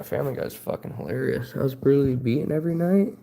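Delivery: ordinary talk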